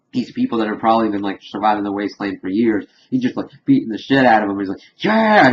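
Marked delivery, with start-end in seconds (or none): 5.0-5.5 high, loud acted cheer; excited yell. Morty beating someone up in Thunderdome, excited yell. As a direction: whoop it out like Morty mid-rampage.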